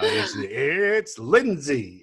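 drawn-out booming ring announcer; Michael Buffer. Pretend. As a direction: stretch the name like a boxing announcer. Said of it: "my, my Michael Buffer, uh, impersonation".

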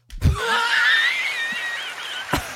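very high, loud laugh; shrieking laugh. Real, not acted. Cracking up at a dad joke, shrieking laugh. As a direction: burst into a long shriek of laughter.

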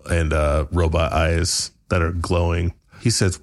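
normal talking voice

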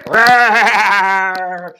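very loud, long roar; big playful held roar; bear roar. Pretend. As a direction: roar at full volume like a bear.

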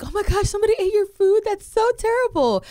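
high, gushing fake sympathy; mock sympathetic coworkers. Coworkers fussing over her eaten food, high gushing sympathy. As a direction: gush over it like shocked coworkers.

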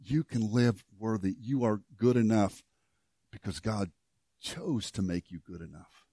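soft, gentle encouragement; Paul softly telling you that you are good enough; Paul reassuring. Acted. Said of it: "Paul saunters up beside you and says".

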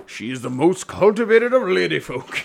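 hammed-up clergyman accent; Doctor Chasuble's character voice. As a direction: praise her as a comic reverend. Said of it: "this is the character".

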